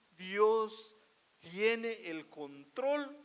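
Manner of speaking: usual speaking voice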